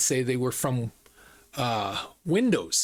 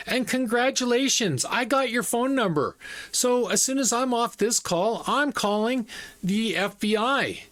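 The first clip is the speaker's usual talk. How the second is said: higher-pitched, mock-congratulatory warning; retold triumphant threat; 8 semitones higher than usual. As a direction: act out telling off a scammer.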